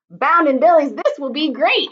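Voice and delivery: loud, eager cry while running; running goatherd cheering; excited on the run. Acted. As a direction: cheer as you join the chase. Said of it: "he said, on a dead run".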